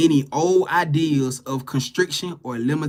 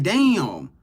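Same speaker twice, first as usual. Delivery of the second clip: ordinary talk, nothing marked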